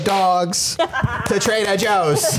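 high, drawn-out mock outrage; scolding online mob. Online crowd scolding the dog owner, high mock outrage. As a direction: draw it out high, like an indignant commenter. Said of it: "everybody was like".

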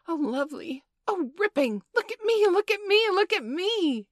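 very high, loud squeals, building; thrilled exclaiming. A narrator's reading. Children flying near the ceiling, squealing with excitement. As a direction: shriek for attention like an overjoyed child.

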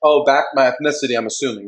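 ordinary delivery

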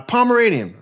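a hammed-up high-pitched cop saying they're on their way; mock eager cop. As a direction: squeal it like a delighted cop rushing over. Mocking eager police response.

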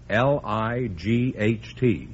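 ordinary delivery

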